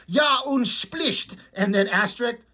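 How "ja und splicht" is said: slow, mock German gibberish. Nightcrawler spouting fake German, slow mock accent. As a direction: put on a thick German accent. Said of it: "He's like".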